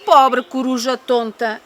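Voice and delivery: ordinary delivery, nothing marked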